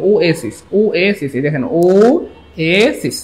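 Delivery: ওয়েসিস as "oh-ay-sis", the last one stretched out; pronunciation demo. Shown as a demonstration. Teacher recapping how it is pronounced, ওয়েসিস drawn out at the end. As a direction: repeat ওয়েসিস, then slow it into long syllables. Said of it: "তারপরে ওটার উচ্চারণটা এই যে".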